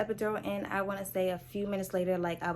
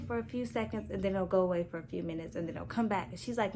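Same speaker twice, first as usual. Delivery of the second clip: usual speaking voice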